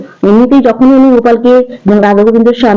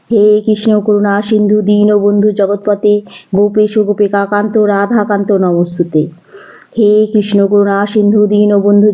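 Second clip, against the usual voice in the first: soft, measured devotional recitation; prayer mantra; 4 dB quieter than usual. Real, not acted. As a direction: intone the Sanskrit shloka gently, like a prayer. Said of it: "আমি একটাই মন্ত্র উচ্চারণ করি". (নরম স্বরে ভক্তিভরে শ্লোক পাঠ)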